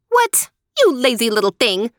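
very high, loud, fast outraged yell; spoiled sister yelling at Marushka; angry shout. Acted. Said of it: "Helena shouted".

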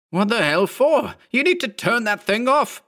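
very high, agitated bark; barked order. A narrator's reading. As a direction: bark it in sharp protest. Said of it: "Mark barked".

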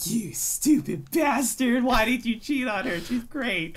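high, slow mock scolding; yelling at the character. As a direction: scold the cheater from the couch. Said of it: "and I'm just like".

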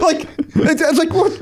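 high, incredulous laughing. Real, not acted. Telling friends about the parking lot threat, laughing. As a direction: laugh through the disbelief.

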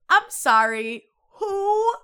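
loud, still laughing; trying to recover but cracking up; laughing apology. Real, not acted. Apologizing to the caller while still laughing.

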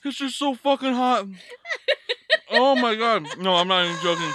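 high, loud wail breaking into laughter; pained laughing. Real, not acted. Podcaster overwhelmed by hot sauce, laughing in pain. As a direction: laugh helplessly through the burn.

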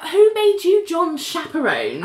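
high-pitched, amused outrage; laughing indignation. Retold or imitated. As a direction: challenge the busybody, half laughing. Indignant reaction to nosy friend.

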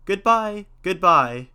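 loud, high farewell call; hearty parting shout from a storybook hero; crying goodbye. A narrator's reading. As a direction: call back to those left behind. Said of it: "cried Momotaro".

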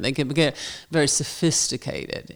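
mock-sophisticated Southern woman, hushed; designing woman. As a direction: drop to a soft, cultured Southern purr. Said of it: "they become very designing women".

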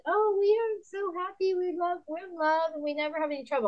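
high, mock-sweet gushing; blissful perfect couple. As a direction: mimic a smugly happy couple. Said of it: "or these great couples that".

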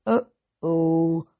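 slow, dopey giant uh-oh; giant's uh-oh. A narrator's reading. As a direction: let it out like a clumsy giant. Giant says uh-oh again.

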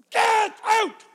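sudden, loud high shout; shouted rebuke. Retold or imitated. Preacher reenacting his rebuke to the woman, shouted. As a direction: bellow a command to leave.

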